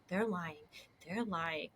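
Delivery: low, hushed accusation; retold suspicious aside. Retelling her aside about the seat-takers, quietly suspicious. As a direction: drop right down as if muttering to a companion.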